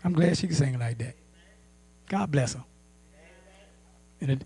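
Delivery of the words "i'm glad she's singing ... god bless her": low, soft acted blessing; quiet self-correction. Believer arresting an envious thought, quiet self-correction. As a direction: say it quietly to yourself, low and kindly. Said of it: "You said".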